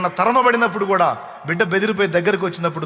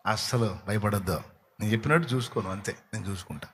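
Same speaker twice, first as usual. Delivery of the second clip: much softer and lower; tender reassurance; 8 semitones lower, 10 dB quieter than usual. Acted. God soothes the frightened believer, hushed.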